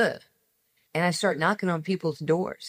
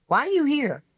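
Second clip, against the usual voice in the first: higher, sharp challenge, imitating the woman; challenging woman; 10 semitones higher, 4 dB louder than usual. A woman at the Wiley lecture confronting her, mock-challenging. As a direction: throw the question at her like an accusation.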